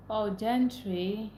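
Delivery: ordinary talk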